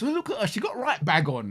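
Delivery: high-pitched Midlands gossip, half laughing; Midlands girl impression. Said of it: "when I was up in the Midlands".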